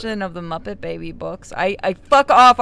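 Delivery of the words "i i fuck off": much louder, slow, playful fuck off; mock outraged retort. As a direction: snap back in fake outrage while laughing inside. Embarrassed retort to teasing.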